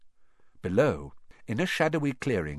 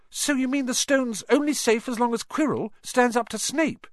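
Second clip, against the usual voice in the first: high, alarmed question; alarmed Hermione; 4 dB louder than usual. A narrator's reading. Hermione alarmed to Harry and Ron about the stone. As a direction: ask it in sudden alarm, much higher. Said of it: "said Hermione in alarm".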